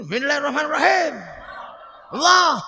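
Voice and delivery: quick, hard basmala ending in a barked Allah; brisk booming recitation; Umar's forceful recitation. Retold or imitated. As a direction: fire it off like the stern Umar. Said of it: "Kalau Sayyidina Umar".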